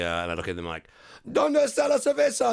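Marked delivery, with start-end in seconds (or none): 1.3-2.5 very high, loud singsong ¿Dónde está la cerveza?; mock proud Spanish. Asking coworkers for beer.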